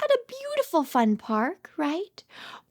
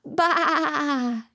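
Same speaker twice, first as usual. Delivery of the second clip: drawn-out quavering baa; sheep bleat; 3 semitones lower than usual. Pretend. Teacher repeating the sheep's sound after praising the child, long bleat. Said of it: "That's a little sheep".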